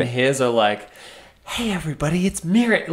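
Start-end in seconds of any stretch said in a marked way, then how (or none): 1.4-2.9 whisper shouting. Retold or imitated. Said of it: "he's whisper shouting".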